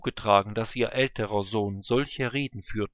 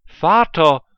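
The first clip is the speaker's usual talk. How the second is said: loud, high anguished cry of Vater; bitter cry; 6 semitones higher, 13 dB louder than usual. A narrator's reading. Esau cries out bitterly to his father. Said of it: "schrie er voller Bitterkeit laut auf".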